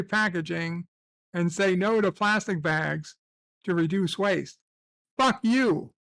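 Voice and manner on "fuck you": mock angry retort. Mock rant at eco message.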